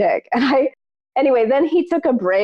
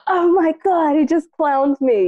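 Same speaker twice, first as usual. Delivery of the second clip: higher-pitched mock disbelief; stunned; 7 semitones higher than usual. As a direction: act shocked and humiliated, pitched up. Stunned after teacher walks off.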